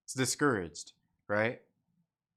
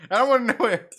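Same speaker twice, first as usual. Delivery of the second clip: laughing; 9 semitones higher, 9 dB louder than usual. Real, not acted. Laughing disbelief at chat.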